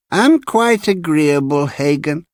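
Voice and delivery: put-on high, slow, agreeable old man; cracked-bell voice. A narrator's reading. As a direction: answer slowly in a small high cracked voice. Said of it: "said Mister Honeyman".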